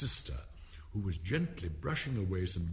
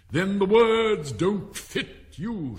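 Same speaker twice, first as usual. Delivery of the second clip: high, loud, drawn-out delivery; punning King; 10 semitones higher, 9 dB louder, much slower than usual. A narrator's reading. King making a pun for the court, loud and drawn out. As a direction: play the wordplay to the whole court.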